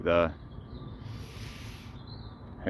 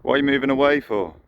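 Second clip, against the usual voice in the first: higher and louder, mock offended; mock hurt; 7 semitones higher, 6 dB louder, faster than usual. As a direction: call after them as if snubbed. Ducks walk away from him.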